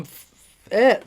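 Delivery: burp mid-sentence. Real, not acted. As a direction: talk through a burp. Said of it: "I'm just burping".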